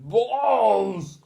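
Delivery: high, loud bellow. Acted. Teacher bellowing while charging around, loud and high. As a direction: bellow across the playground.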